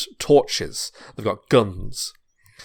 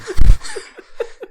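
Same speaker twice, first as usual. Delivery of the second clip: loud burst of laughter; 15 dB louder than usual. Real, not acted.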